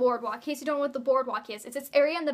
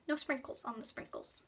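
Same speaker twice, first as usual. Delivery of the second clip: soft muttering; 12 dB quieter than usual. Acted. Muttered joke about sprinkles machine.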